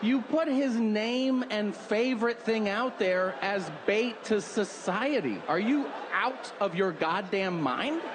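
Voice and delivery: hammed-up outrage at careless parents; indignant rant. Rants at imagined parents.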